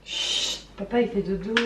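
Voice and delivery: hushed, soft shush; whispering over a crib. Real, not acted. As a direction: keep it down, someone's sleeping. Hushing child, dad asleep.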